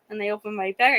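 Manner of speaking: ordinary talk, nothing marked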